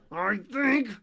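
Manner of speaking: crocodile speaking through clenched teeth; through his teeth. A narrator's reading. Crocodile through his teeth, gripping the nose. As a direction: grind it out between teeth. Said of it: "between his teeth".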